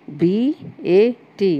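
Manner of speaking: slow, separated letters B, A, T; spelled letter by letter. Shown as a demonstration. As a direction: say बी ए टी one letter at a time. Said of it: "यानी ये तीन अक्षर हैं".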